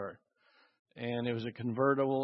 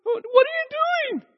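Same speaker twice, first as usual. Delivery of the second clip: very high, very loud furious scream; young man screaming as his car is rammed; screaming in anger; 11 dB louder than usual. Retold or imitated. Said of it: "he screamed out in anger".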